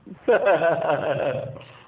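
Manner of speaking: a loud, real laugh that fades out; loud burst of laughter. Real, not acted. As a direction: break into open laughter at your own threat. Laughing after saying she'd kill her.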